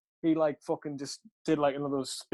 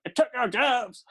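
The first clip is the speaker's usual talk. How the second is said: mock outrage; 10 semitones higher, 4 dB louder, slower than usual. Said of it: "I was like".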